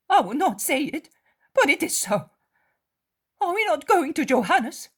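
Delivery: high, loud reluctant agreement; grudging friend. Acted. Mary of Alphaeus grudgingly agreeing to pray. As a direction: agree but resist.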